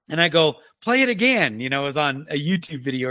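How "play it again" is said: calling out. Retold or imitated. Calling to wife across room.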